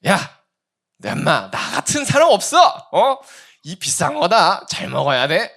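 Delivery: loud, pushy uncle handing over a gift; boastful older man. Retold or imitated. Sixty-something man pushing his red ginseng gift on a young man, loud and boastful. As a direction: brag about the pricey ginseng, loudly. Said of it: "어떤 육십 대 아저씨가".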